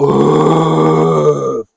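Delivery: big, forceful breath pushed out; loud exhale. Shown as a demonstration. Coach demonstrating the exhale, forceful breath out. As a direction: blow every bit of air out. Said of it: "Exhale deep, everything out".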